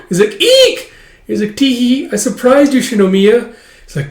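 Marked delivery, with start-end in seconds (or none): 0.4-0.9 very high, loud squeak; shrill mock shriek of surprise; startled eek. Maid startled by the child. 1.5-3.5 put-on high, loud childlike tee-hee; gleeful kid who pulled off a prank; playful little girl. Little girl teases the maid.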